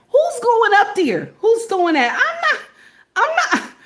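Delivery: high-pitched comic balking; incredulous refusal. Acted. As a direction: protest with the voice leaping up. Balks at going onto the roof.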